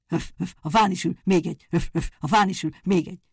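the boar flees the cat, yelling that another sits in the tree; boar shouting as it runs. Acted. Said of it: "kiáltotta futás közben". (futás közben kiabáló, röfögő vaddisznó)

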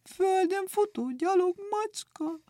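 a very high, plaintive, whimpering cat voice; frightened cat. A narrator's reading. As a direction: mewl it out in dismay, near tears. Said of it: "rémült képpel".

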